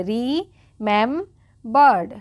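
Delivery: रिमेम्बर्ड split into parts, slow; broken into syllables. Shown as a demonstration. Teacher breaking the word apart for the class, slowly. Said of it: "तोड़ के पढ़ेंगे".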